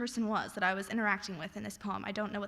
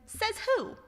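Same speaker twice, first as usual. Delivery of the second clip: put-on rude kid; 12 semitones higher than usual. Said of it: "And you said".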